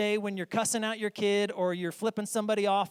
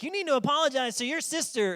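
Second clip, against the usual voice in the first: stern parent; 9 semitones higher, 5 dB louder, faster than usual. Retold or imitated. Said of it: "and you're like".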